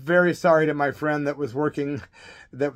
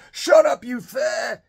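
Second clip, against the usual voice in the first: put-on drunken yell; 7 semitones higher, 4 dB louder, slower than usual. Drunk guy yelling from bar.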